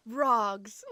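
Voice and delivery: posh British ROGS, long open ah. Shown as a demonstration. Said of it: "like, a posh way of saying".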